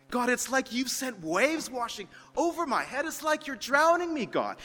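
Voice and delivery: pleading cry to God, pitched high; a voice close to breaking, pouring it out; desperate prayer. Acted. Pastor voicing a drowning man's prayer, pleading high cry.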